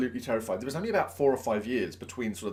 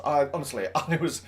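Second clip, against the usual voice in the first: ordinary talk, nothing marked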